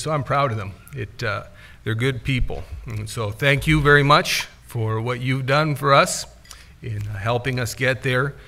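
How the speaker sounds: ordinary talk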